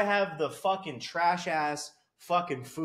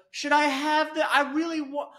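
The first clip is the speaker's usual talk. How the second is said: urgent, stumbling mock begging; mock desperate dieter; 8 semitones higher than usual. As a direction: imitate a needy guy craving treats. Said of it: "this is what the guy said".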